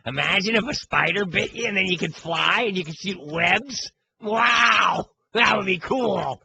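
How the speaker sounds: high-pitched, drawn-out mock of wide-eyed wonder; Cowherd voice. Dude mocking comic book kids upstairs, high put-on wonder voice. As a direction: put on a high, gushing voice of awe. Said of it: "People said that was my Cowherd voice".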